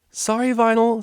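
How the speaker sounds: high, loud, fretful apology; a jittery pegasus blurting sorry; flustered pegasus. A narrator's reading.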